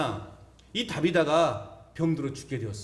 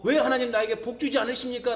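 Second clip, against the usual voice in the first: higher-pitched imitated grumble to God; mock complaint; 6 semitones higher than usual. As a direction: voice a complainer pleading why. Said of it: "그렇게 불평한다면".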